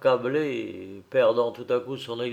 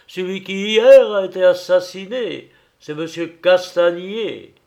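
exclaiming; 2 semitones higher, 6 dB louder than usual. Acted. Son revealing the murdered banker, building to a loud cry. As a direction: reveal the name with rising force. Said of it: "s'écria Robert".

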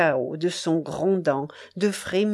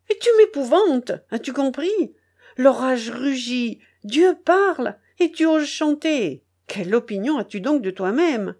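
angry scolding; 4 semitones higher than usual. Acted. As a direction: scold him for daring to sing. Charcot scolding the singing narrator.

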